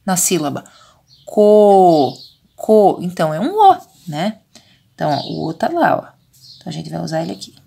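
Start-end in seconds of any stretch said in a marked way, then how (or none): 1.2-2.1 CO with a long, loud held O; drawn-out vowel. Shown as a demonstration. Teacher helping children hear the vowel, drawn-out CO. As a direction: stretch the O in CO so it rings out. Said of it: "Eu já estou falando a vogal".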